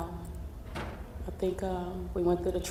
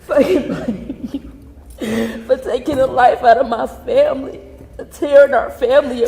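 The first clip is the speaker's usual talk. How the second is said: loud, anguished, high outburst; yelled accusation; 5 semitones higher, 11 dB louder than usual. Real, not acted. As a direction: yell it through tears of rage. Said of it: "fucking yell at the guy".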